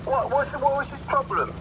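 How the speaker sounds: high-pitched mock youth voice; slang-talking youth. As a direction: act a curious young mate asking. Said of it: "hit someone with a rolled up newspaper".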